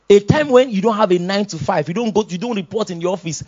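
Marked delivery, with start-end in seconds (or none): none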